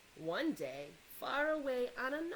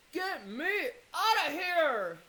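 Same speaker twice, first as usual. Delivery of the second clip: loud, slow desperate yell; 5 semitones higher, 10 dB louder, slower than usual. Acted. Father stuck in river mud yelling, loud and slow. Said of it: "he yelled".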